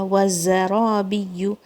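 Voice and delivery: وَزَرَابِيُّ slow, و not like v, ز buzzing. Shown as a demonstration. Teacher models وَزَرَابِيُّ slowly, buzzing the z. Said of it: "یہاں پہ buzzing sound ہے".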